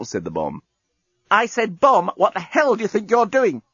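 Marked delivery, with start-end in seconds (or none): 1.3-3.6 high-pitched, exasperated repeat; exasperated demand. Acted. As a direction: insist indignantly, pitched well up. Journalist challenges bomb again.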